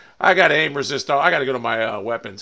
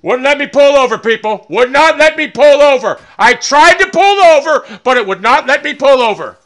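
loud, higher-pitched angry yelling at the game; furious rant; 7 semitones higher, 13 dB louder than usual. Real, not acted. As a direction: rage at the car that won't pull over. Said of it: "I was so fucking pissed".

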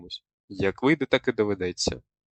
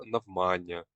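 «навмання» wrongly stressed on «ма», said softer; 3 semitones lower, 6 dB quieter, slower than usual. Shown as a demonstration. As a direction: stress the middle syllable «ма» in «навмання». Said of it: "Ніяких навмання або на". («навмання» з наголосом на середній склад «ма»)

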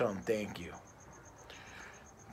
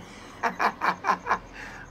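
laugh; 12 semitones higher, 4 dB louder than usual. Real, not acted. Laughs after insulting friend.

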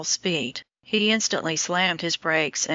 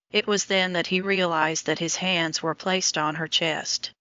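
ordinary delivery

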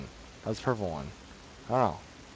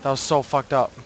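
normal talking voice

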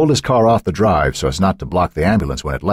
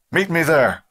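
radioed order; 12 semitones higher, slower than usual. A narrator's reading. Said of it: "Pullman ordered".

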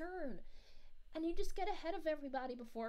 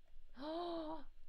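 long, soft intake of breath; delighted gasp; 5 dB quieter than usual. Real, not acted. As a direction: gasp with delight at good food. Delighted by news of burgers.

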